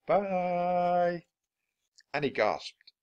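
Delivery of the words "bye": high, faraway echoing voice; distant echo. Acted. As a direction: sound like it bounces off the walls. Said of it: "a voice called".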